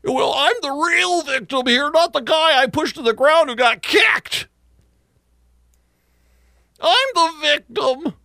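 high, slow whiny mock victim. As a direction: whine it like a self-pitying defendant. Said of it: "Once again".

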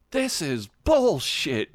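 slow, high exasperated declaration; mock fed-up growl. Superhands fed up with the stuck door, slow mock exasperation. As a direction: draw it out in hammed-up disgust.

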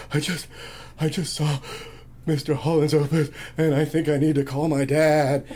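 hammed-up sobbing between the words; mock blubbering. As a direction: choke it out like an imitated crybaby. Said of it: "I couldn't get the words out".

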